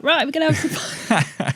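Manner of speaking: high, giggly rallying call; gleeful once the bosses leave; laughing mischief. Retold or imitated. Rallying coworkers once managers left, giggling.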